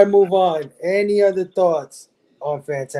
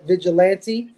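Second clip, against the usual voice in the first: normal talking voice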